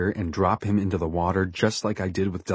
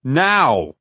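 higher, louder one-word order; commanding shout; 6 semitones higher, 6 dB louder than usual. A narrator's reading. Captain orders Forty to the wheelhouse, commanding shout. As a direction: demand obedience at once. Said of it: "he commands".

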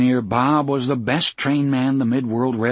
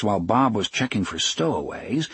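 ordinary delivery

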